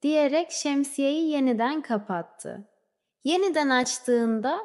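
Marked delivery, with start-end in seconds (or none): none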